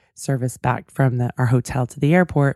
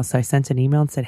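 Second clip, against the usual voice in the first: ordinary delivery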